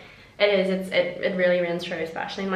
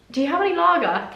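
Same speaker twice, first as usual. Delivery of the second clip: imitated English accent, pitched up high; 8 semitones higher than usual. Customer asking her waitress for a drink, imitated English accent. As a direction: put on an English woman's accent. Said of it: "she had an English accent".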